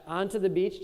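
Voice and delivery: ordinary talk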